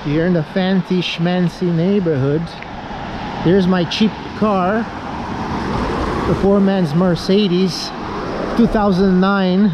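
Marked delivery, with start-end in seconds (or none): none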